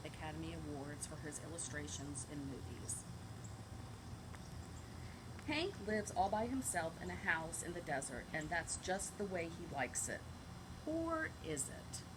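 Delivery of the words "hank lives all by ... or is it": put-on storybook blurb read to kids; reading to children. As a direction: tease the question at the end. Reads book blurb to children.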